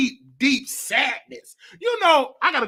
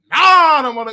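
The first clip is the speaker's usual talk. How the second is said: loud, rude no, much louder; with authority; 4 semitones higher, 9 dB louder than usual. Retold or imitated. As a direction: snap the refusal with full authority. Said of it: "I said it with authority, ignorant".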